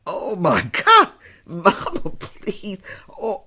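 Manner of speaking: half-laughing, half-groaning protest; exasperated laugh. Real, not acted. Daughter laughing off her mother's old photo-album remark, exasperated. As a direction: laugh it off in disbelief.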